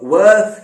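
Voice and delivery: worth slow and loud, th stretched; soft th demo. Shown as a demonstration. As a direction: lean on the th of worth. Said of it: "soft ٹی ایچ آتا ہے".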